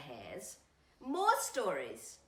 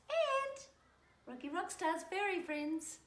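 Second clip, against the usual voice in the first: playful high greeting; 9 semitones higher than usual. Acted.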